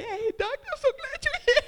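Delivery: very high, loud, overjoyed squeal; hammed-up desperate welcome; gushing gratitude. Laboring woman greeting the doctor, gushing gratitude.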